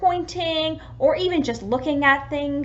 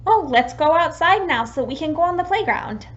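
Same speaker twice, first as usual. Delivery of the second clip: put-on bright, louder invitation to a child; cheerful invitation; 5 semitones higher, 5 dB louder than usual. Shown as a demonstration. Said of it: "you could say".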